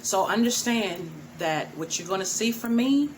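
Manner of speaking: ordinary delivery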